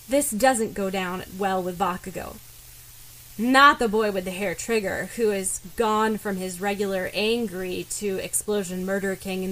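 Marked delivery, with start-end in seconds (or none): none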